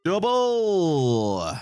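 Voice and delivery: high, drawn-out cheer of Double!; excited callout. Real, not acted. Streamer calling out a big loot to chat, high excited cheer. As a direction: call it out like a jackpot.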